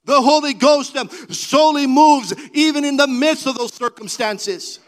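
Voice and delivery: loud, rising preacher's cry over music; preaching at full force. Acted. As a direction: build the sermon to a fervent climax.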